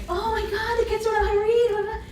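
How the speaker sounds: high, loud frantic rush; panicked outburst. Retold or imitated. New teacher panicking to her family, high and loud.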